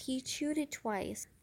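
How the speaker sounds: usual speaking voice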